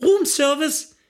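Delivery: high, repeated door call; acted chambermaid's high call; insistent calling maid. A narrator's reading. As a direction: keep calling through the door as room service. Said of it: "hämmerte es unaufhörlich an der Tür".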